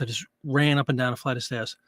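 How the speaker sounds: usual speaking voice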